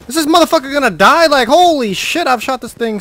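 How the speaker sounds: high, loud disbelieving shout; exasperated yell. Real, not acted. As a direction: shout in frustration. Bear won't die.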